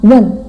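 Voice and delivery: "wen" pushed out sharply downward; falling tone wen. Shown as a demonstration. Teacher stamps "wen" for students, sharp fall. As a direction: hit "wen" hard and drop it.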